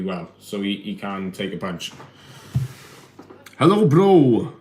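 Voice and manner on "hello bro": high, loud hello to a viewer; excited greeting. Real, not acted. Reading a Russian viewer's greeting.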